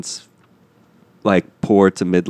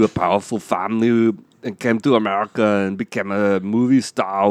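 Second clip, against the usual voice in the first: put-on thick Austrian accent; Arnold Schwarzenegger. Guest explains history to kids, Austrian accent. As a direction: lecture like an Austrian bodybuilder. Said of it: "Arnold Schwarzenegger, come out".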